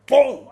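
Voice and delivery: sudden loud high boom as the smell hits; mock explosion sound. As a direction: blast it out like an impact.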